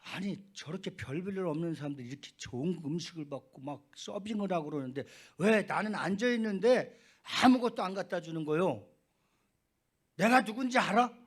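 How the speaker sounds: acted grumble rising to a loud, high demand; indignant complaint. Congressman berating heaven's angel manager, acted indignant complaint. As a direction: play a self-important man building to mock outrage. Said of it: "막 따지는 거예요".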